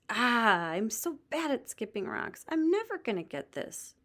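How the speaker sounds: put-on high, frustrated wail of a boy; a kid giving up in frustration. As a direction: whine it out, fed up with yourself. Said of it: "clearly frustrated".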